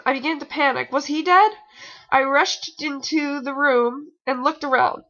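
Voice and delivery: usual speaking voice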